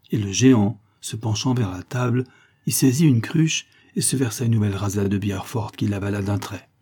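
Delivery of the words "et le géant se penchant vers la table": usual speaking voice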